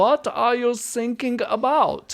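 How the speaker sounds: put-on loud, high German-accented punchline; hammed-up German, th turned to s. Coach acting a joke's coast guard, loud mock German accent. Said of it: "that joke about the ship calling the Coast Guard".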